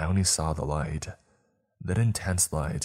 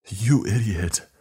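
louder, higher, slow, raging; shriek; 5 semitones higher, 6 dB louder, slower than usual. A narrator's reading. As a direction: scream it in fury. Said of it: "Tommy shrieked".

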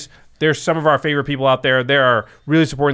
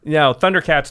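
usual speaking voice

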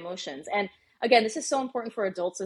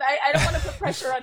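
ordinary talk

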